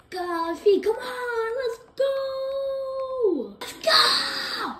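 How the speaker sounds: high, loud, drawn-out hype shout; excited cheering. Real, not acted. Gamer celebrating Guffey on the team, excited screaming.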